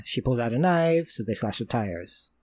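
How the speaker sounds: ordinary talk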